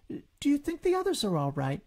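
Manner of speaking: put-on high little child voice; worried Roo. A narrator's reading. Roo asks his mother about the others, childlike. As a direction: ask with a child's worry.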